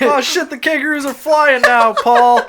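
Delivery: high, loud comic alarm; mock alarm. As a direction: cry out in slow mock panic. Mock panic at flying kangaroos.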